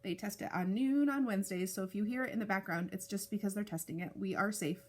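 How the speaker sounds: ordinary delivery, nothing marked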